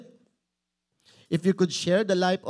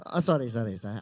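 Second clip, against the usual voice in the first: normal talking voice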